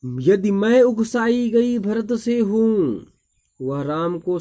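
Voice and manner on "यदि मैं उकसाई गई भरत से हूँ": the line "यदि मैं उकसाई गई भरत से हूँ" declaimed, pitched higher with weight on "मैं"; poem recitation. Acted. Teacher recites Kaikeyi's line to class, declaiming.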